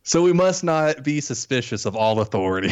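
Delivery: laughing while reading. Real, not acted. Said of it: "I can't get through it without laughing".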